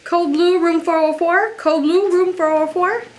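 urgent loud alarm call; shouting for help. Acted. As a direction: raise the alarm, loud and high. Nurse shouts code alert.

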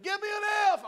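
high shouted cheer call. Retold or imitated. Classmate yelling the wrong letter, shouted high. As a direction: yell it to the bleachers. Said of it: "got to the third letter and said".

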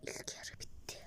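barely audible whisper; drained whisper at half time. Real, not acted. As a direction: whisper it to yourself.

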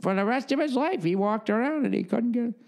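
put-on high old neighbor lady voice, winding up the ending; old lady telling tales. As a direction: finish the spooky story as the old lady. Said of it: "she'd be".

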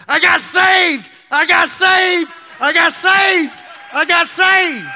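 very high, loud, slow joyful shouting; jubilant shout. Retold or imitated. Running down the driveway to his friend, shouting for joy. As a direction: yell it out as loud as you can.

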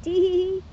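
high sly tee-hee-hee giggle; high mischievous witch titter. Acted.

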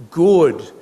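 ordinary delivery

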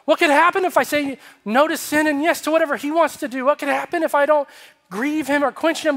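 high, loud, fervent sermon delivery; impassioned preaching. Real, not acted. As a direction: preach with urgency, pressing each question on the congregation. Preaches at full force after the story.

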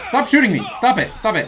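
loud, high protest at the enemy; panicked shouting at the screen; frantic yell. Real, not acted. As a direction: yell it while being shot. Shot at in game, yelling.

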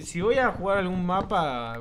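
ordinary talk

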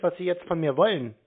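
normal talking voice

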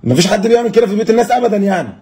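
high stern scolding. Acted. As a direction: tell him off sharply. Father scolds the joker.

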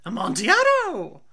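loud, high cry of the wine's name; excited drunk Fortunato. Acted. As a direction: shout it out, hooked on the wine.

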